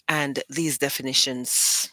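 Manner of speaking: normal talking voice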